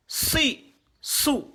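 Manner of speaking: "si", "sou" brief and high; short vowels. Shown as a demonstration. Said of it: "les voyelles brèves".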